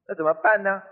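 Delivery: ordinary talk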